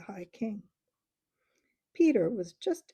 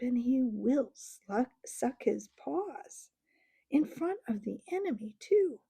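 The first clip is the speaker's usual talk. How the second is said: exasperated; 5 semitones higher than usual. Acted. Trumpkin complains about the bear.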